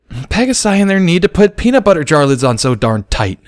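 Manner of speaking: high, loud grumbling; exasperated complaint; cursing. Acted. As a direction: struggle with the jar lid. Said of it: "cursed Sparkler".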